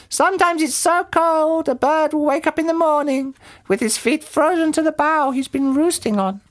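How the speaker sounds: high-pitched bird character; roly-poly bird. A narrator's reading. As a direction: warn in a very high creature voice. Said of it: "said the roly-poly bird".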